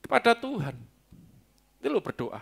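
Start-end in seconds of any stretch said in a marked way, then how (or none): none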